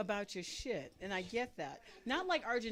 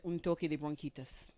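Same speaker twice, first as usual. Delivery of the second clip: Spanish phrase; 5 semitones lower than usual. Retold or imitated. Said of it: "my doctor said".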